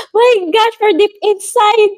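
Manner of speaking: giddy fan gush. Retold or imitated. Fan gushing to idol.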